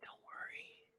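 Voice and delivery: whispered reassurance. Acted. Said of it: "all of a sudden he says".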